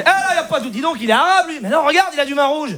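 mock astonished debate among regulars; arguing onlookers. Acted onlookers debating his drinking.

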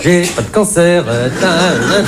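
attempted cancer accent. Comic shows how hard faking the cancer accent is, attempted cancer voice. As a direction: drag it out, then give up on the imitation. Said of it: "d'imiter l'accent cancéreux".

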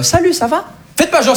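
put-on high, chirpy Quebec-accent greeting. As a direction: sing it up like a Montreal local, Quebec accent. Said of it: "j'ai pris l'accent québécois". (accent québécois aigu et enjoué)

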